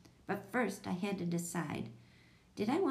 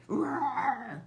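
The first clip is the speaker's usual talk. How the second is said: loud, higher-pitched roar; monster roar; 6 semitones higher, 5 dB louder than usual. A narrator's reading. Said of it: "Jack roared".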